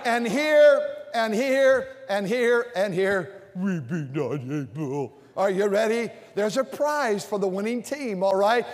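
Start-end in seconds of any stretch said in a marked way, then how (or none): 3.6-5.1 low, soft, slow whine; whiny pout. Shown as a demonstration. Preacher modelling the doubting side's line, low. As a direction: sulk it out. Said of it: "you have to sort of whine".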